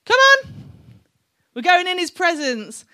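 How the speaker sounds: loud, high Birmingham call to the congregation; rousing Birmingham-accented holler; Birmingham accent rallying cry. Retold or imitated. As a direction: shout it like a Birmingham worship leader. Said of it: "in a Birmingham accent".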